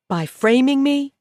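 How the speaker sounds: can't believe what she hears; outraged disbelief at the frame-up; incredulous outrage. A narrator's reading. Prisoner reacts to her framer's boast.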